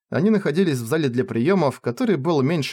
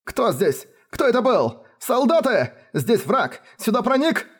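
high panicked calling for help; panicked shout; 10 semitones higher than usual. Acted. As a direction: shout for the guards in terror. Noble shouting for soldiers.

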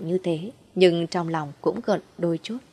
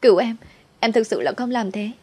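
pleading wife; 5 semitones higher than usual. Acted. Wife begs husband caught cheating.

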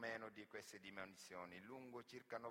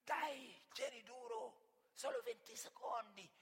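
higher, louder cheering on, slow; urging on; 11 semitones higher, 7 dB louder, slower than usual. Acted. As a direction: egg him on like a punter at a race. Bettor urging hanged man to last.

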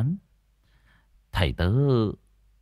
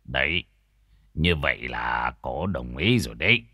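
low-pitched, easy master's voice; smiling; 7 semitones lower, 4 dB quieter than usual. A narrator's reading. Master tells couple the spirit agreed, low smiling voice. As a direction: reassure them in a low voice with a smile. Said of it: "mỉm cười nói".

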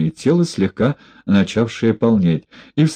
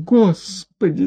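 high, slow lament; wailing; 10 semitones higher, slower than usual. A narrator's reading. Женщина причитает в постели перед инспектором. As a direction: wail it out in despair. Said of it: "запричитала в постели женщина".

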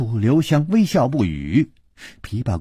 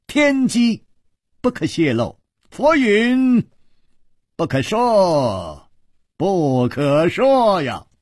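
high, drawn-out mock-sage intoning; mystifying pronouncement; 8 semitones higher, slower than usual. A narrator's reading. Chu Liuxiang teases the princess with Zen talk, grandly intoning. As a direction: stretch each phrase like a mysterious oracle.

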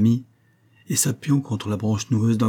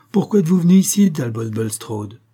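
higher, louder, fast burst, then Talbot slowed; vehement repeat; 5 semitones higher, 5 dB louder than usual. A narrator's reading. John demanding why his friend came, in an outburst. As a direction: fling the question at him.

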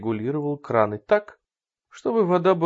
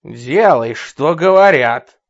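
angry command; 11 dB louder, much slower than usual. Acted. Kidnapper threatens mother.

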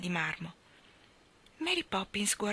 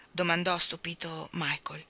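ordinary delivery

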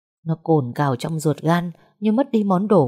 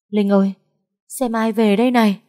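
calling out excitedly; 5 semitones higher than usual. A narrator's reading. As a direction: call out with happy news. Calling out to wake Linh. (giọng gọi to, hớn hở)

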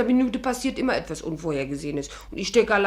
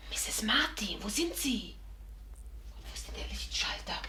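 leise geflüstert ins Dunkle; searching whisper; 10 dB quieter than usual. Acted. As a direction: vorsichtig rufen, ohne Stimme. Sucht im dunklen Zimmer. (Celia flüstert im dunklen Zimmer nach Misses Martin)

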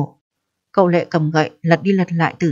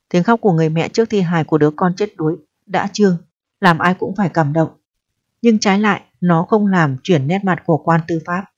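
usual speaking voice

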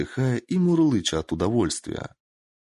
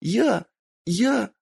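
moaning; 14 semitones higher than usual. A narrator's reading. Kitsune in the arm lock, moaning. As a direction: moan out each "Я!". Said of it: "стонала Кицуне".

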